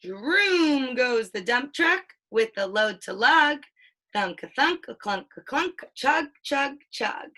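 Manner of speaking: lively picture-book read-aloud, playing up the sound words; reading to a child. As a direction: read it like a bedtime book for a toddler.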